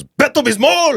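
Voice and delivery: put-on high, laughing Spanish-accented kid's shout. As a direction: blurt it like the TV puppet. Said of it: "the first thing out of my mouth was".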